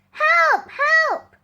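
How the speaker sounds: high, loud panicked shout; fake cry for help. Sam tricking the village again, loud high fake alarm. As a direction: yell for help as if terrified.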